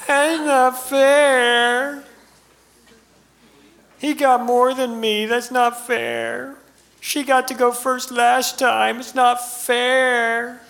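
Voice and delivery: put-on high, slow childish whine throughout; a kid whining about fairness to a parent, high and drawn out. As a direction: complain like a jealous sibling.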